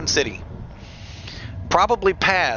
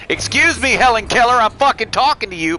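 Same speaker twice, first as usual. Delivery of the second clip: mock yell; 6 semitones higher, 5 dB louder than usual. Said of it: "and say".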